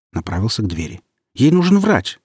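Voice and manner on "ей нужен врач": high, loud shout after him. A narrator's reading. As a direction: call after the departing guard. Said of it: "крикнула вслед Татьяна".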